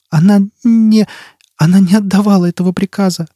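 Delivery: put-on high-pitched, timid child's voice; little child voice, pitched well up; frightened child. A narrator's reading. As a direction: answer as a scared small child. Frightened child telepath answering Foyle.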